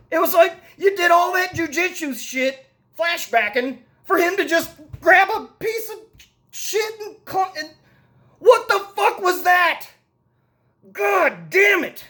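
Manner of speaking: incredulous outburst at the movie; exasperated rant. Real, not acted. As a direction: throw up your hands at a ridiculous scene. Ranting about the dumb fight ending.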